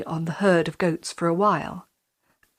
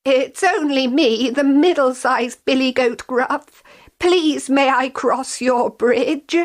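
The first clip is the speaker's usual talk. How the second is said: higher, polite goat voice; middle goat voice; 6 semitones higher, 3 dB louder than usual. A narrator's reading. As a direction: ask nervously like a young goat.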